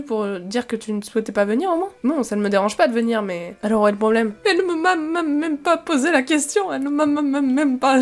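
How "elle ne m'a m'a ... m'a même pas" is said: high-pitched, stuttering indignation; real stumbling stutter, pitched up; accidental stammer. Real, not acted. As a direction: trip over the "m" and keep restarting. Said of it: "j'ai bégayé sans le vouloir".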